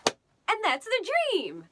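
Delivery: grand finale. Pretend. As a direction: announce it like a big finale. Grand finish of recital.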